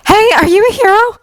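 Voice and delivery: high-pitched urgent call. A narrator's reading. Blonde woman hailing a hero on the battlefield, calling out. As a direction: call across the fight in a high voice. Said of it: "called out a blonde-haired woman".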